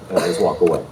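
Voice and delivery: ordinary talk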